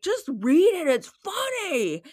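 high, loud mock insistence, stretched at the end; put-on cross ten-year-old voice; mad kid imitation. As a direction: imitate a frustrated child demanding attention. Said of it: "he's, like, really mad".

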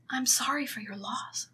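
hushed whisper of sympathy; mock sympathetic whisper. Carmen consoling Johnny, mock hushed whisper. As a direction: whisper it like a hollow condolence. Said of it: "And she's like".

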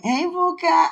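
mock hey Buka called high and loud; teasing call. Teasing a friend with a playful nickname, called out high. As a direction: tease the nickname like a playful greeting.

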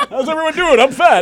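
loud, high comic opener through laughter; mock stage greeting. Comic following a film of a dying child, loud forced opener. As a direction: belt it out to a dead room.

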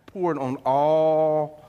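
"all" held long and low; drawn-out ALL. Acted. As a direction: stretch the word "all" right out. Preacher stretches the key word.

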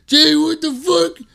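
very high, loud mock scream of rage; slow, strained high-pitched yelling impression; imitated screaming. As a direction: screech it like a friend boiling mad. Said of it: "screaming at me".

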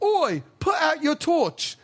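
high, slow British counselor at night; a British counselor telling kids lights out; British accent, stern bunk check. Retold or imitated. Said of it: "they'd be like".

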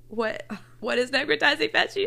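retold disbelief at a scary diagnosis; alarmed question. Telling friends what she asked the doctor, alarmed and rising. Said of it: "and I was like".

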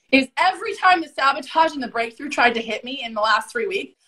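normal talking voice

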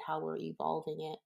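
ordinary talk

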